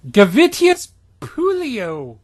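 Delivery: loud, slow, high incantation; spell shout. Acted. Stephanie casting her spell in a loud, slow cry. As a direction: cast it like a wizard at full volume. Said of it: "She called".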